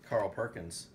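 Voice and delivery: usual speaking voice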